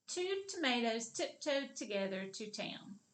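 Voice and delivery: the whole sentence in one unbroken run; all put together. Shown as a demonstration. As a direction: say it straight through without pauses. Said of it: "Now let's put it all together".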